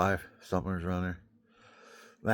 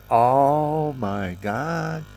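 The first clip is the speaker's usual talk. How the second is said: slow, drawn-out relief; relieved sigh; 4 semitones higher, 7 dB louder, much slower than usual. Real, not acted. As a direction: let it melt out slowly. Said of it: "It's vibrating my forehead".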